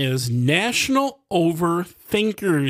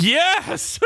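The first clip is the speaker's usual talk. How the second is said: ordinary talk, nothing marked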